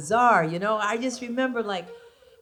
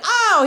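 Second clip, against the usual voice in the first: moan; 9 semitones higher, 4 dB louder than usual. Retold or imitated. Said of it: "I'm like".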